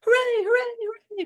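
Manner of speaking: high, excited hooray; crowd cheering. A narrator's reading. People cheer the king, high and excited. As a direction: cheer it like a crowd throwing hats. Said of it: "cried all the people".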